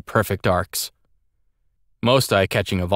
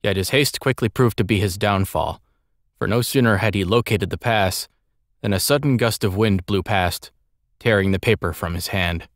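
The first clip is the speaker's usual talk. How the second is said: ordinary delivery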